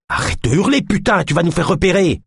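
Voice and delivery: high, urgent scolding; alarmed angry order. A narrator's reading. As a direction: shout down someone about to give you away. Struggling, orders the screamer to stop. (réprimande paniquée, voix aiguë)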